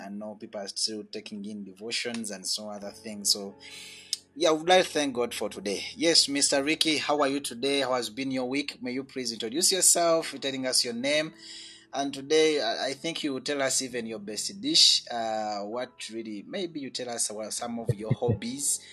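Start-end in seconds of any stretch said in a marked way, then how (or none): none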